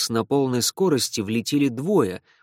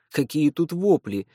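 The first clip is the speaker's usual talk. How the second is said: ordinary delivery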